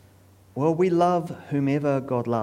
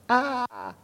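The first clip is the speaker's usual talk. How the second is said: high acted "ah" of someone falling; high, unforced falling yell; falling cry; 12 semitones higher than usual. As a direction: cry out as you're thrown overboard. Thrown overboard into the sea.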